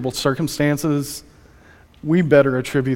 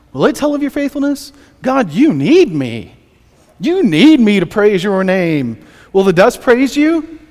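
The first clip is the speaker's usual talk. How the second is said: pleading bargain, pitched up on the questions; David; 5 semitones higher, 5 dB louder than usual. Acted. David bargaining with God for his life, insistent pleading. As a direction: haggle insistently, leaning hard on the key words. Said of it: "this bargaining with God".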